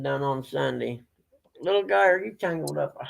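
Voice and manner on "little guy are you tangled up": high voice for pets; talking to dog. Real, not acted. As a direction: coo at the little dog.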